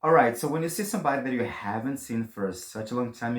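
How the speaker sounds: ordinary talk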